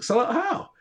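high-pitched, rising puzzled question; retold disbelief, pitched right up; puzzled comeback. Retelling a reply to a friend who called him sellout, puzzled.

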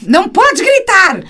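high, drawn-out yell imitating a scolding adult; hammed-up telling-off at full pitch; mock shouting. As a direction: shout the ban at the children, stretched out. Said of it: "Mas nós estamos a gritar".

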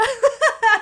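a burst of high loud laughter. Real, not acted. Laughing at his remark.

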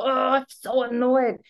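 high, slow, hammed-up annoyance; mock annoyed whine. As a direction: whine it like a playful tantrum. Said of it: "was all".

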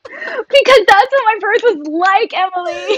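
high, stretched, laughing exclamation; squealed mock-defensive reply; laughing protest. Real, not acted. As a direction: protest through your laughter. Laughing protest at friend's teasing.

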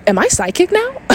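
high-pitched, incredulous re-enactment; mock freaked-out question. Storyteller reliving opening her eyes afterwards, high startled question. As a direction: ask it in high, alarmed disbelief. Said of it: "I start freaking the fuck out".

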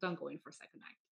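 soft, kind reassurance; gentle, soft. Retold or imitated. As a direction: say it softly to calm her. Edward comforting her.

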